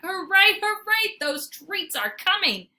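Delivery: high, loud joyful cheer; cheering dog. A narrator's reading. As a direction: whoop with delight. Fenway cheering for treats.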